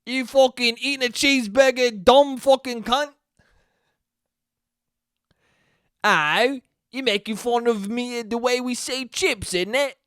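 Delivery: high, indignant fake British accent; Lin-Manuel Miranda mock Cockney. Mock Brit mocking Americans, high British accent. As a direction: sneer it like a cartoon Brit. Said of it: "That's my Lin-Manuel Miranda impression from Mary Poppins".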